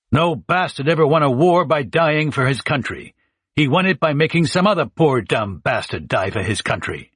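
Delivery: rousing general's speech; gruff general. A narrator's reading. Big Tony recalling Patton's movie line, a lightly voiced general.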